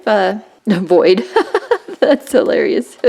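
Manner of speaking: laughing through the words; amused laughter. Real, not acted. Reading a funny stamp, amused laughter.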